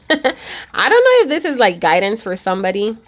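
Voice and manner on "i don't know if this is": laughing. Real, not acted. Laugh breaks into the line.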